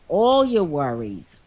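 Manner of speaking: usual speaking voice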